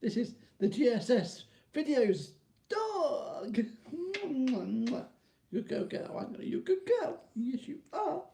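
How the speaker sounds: very high, sing-song pet voice; baby talk to dog. Pretend. Talking to his Jack Russell, squeaky baby talk.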